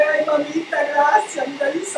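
high, loud gushing Spanish; Papi Food Processor. Pretend. Food processor thanks the cook, high and gushing. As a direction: thank her as an overjoyed pretend gadget. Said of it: "He's like".

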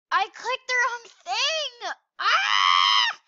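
loud, pained yell at a mistake; real exasperated shout; frustrated cry. Real, not acted. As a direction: howl at your own misclick. Misclicks in the game.

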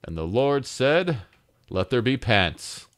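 booming biblical narration. Pretend. Mock God creating pants.

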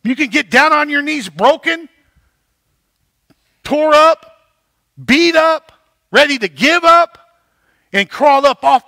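rising preaching. Real, not acted. Pastor to congregation about being broken, building to a loud preach. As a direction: push each phrase harder, like a preacher hitting the peak.

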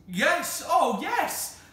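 eager, high, loud yes. Acted. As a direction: cry out eagerly together. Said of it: "said all the children together".

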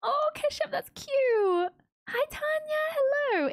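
high-pitched coo to friends; real high cooed hello; cooing greeting. Real, not acted. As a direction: greet them in a high, sweet little voice. Cooing greeting to chat viewers.

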